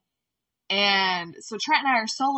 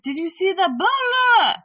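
shouting from a cliff; 10 semitones higher, 9 dB louder than usual. Retold or imitated. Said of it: "She's like".

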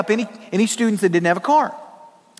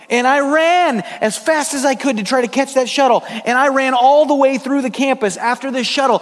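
breathless excitement; 6 semitones higher than usual. Retold or imitated. Preacher to congregation, retelling the shuttle chase, excited and higher. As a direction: tell the chase like you're still running it.

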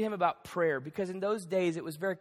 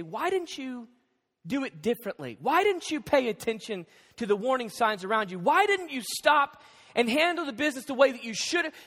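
mock reproach, building louder and higher; hypothetical scolding; 4 semitones higher, 6 dB louder than usual. Jesus imagined scolding us for mistakes, reproachful. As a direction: scold with insistent repeated questions. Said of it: "he could very easily say".

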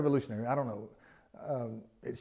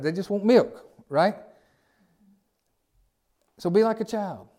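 rising preacher; 6 semitones higher, 4 dB louder than usual. Real, not acted. Preaching raised about children.

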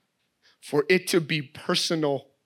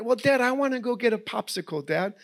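put-on higher, softer sweet little girl; little daughter voice; 5 semitones higher, 3 dB quieter than usual. Daughter telling dad she wants a popsicle, sweet and higher. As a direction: innocent little-girl reasoning.

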